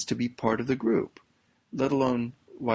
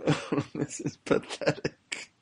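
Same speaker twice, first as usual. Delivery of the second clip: laughing; 3 semitones higher, 5 dB quieter, slower than usual. Real, not acted. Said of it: "I'm laughing".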